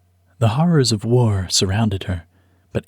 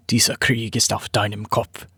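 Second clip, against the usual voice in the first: angry German man. Acted. Bearded man glowers at family.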